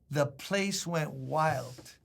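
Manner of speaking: ordinary talk, nothing marked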